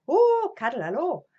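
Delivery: loud, high imitated Welsh greeting; Welsh accent. Terry finds the child at his door, Welsh accent. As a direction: exclaim it in a put-on Welsh lilt. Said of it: "and he was Welsh".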